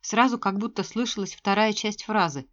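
usual speaking voice

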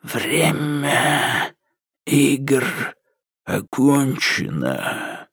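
soft, slow, creaking like dry leaves; low creaky doll voice. A narrator's reading. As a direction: drag it out like a haunted doll. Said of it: "Низкий скрипучий голос, похожий на шелест сухих листьев".

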